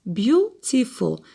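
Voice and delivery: "Beautiful" slowly, split in three syllables; teacher counting the syllables of "Beautiful". Shown as a demonstration. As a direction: sound out "Beautiful" in three separate, slow beats. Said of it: "how many syllables?".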